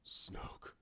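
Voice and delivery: soft whisper; hushed name. Retold or imitated. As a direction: breathe the name out very quietly. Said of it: "then he goes".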